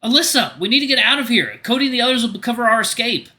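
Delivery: high, loud desperate shout; hollering. Acted. You shout for the rabbit sage to flee, loud holler. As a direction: call her name with burning lungs. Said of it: "You hollered as loud as".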